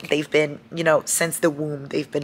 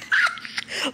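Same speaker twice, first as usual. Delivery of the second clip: 1 breathy laugh; 9 semitones higher, 5 dB quieter than usual. Real, not acted. Laugh at funny quote.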